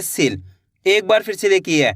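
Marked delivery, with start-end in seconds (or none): 0.0-0.4 सील said quickly with a short i; quick short vowel. Shown as a demonstration. Teacher showing the quick pronunciation, short सील. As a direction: let सील out fast, vowel unstretched. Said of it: "तेजी के साथ उच्चारण निकलता है".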